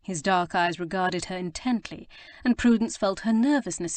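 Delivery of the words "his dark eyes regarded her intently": ordinary delivery, nothing marked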